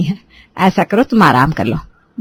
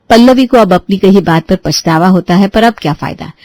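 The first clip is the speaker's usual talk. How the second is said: ordinary delivery, nothing marked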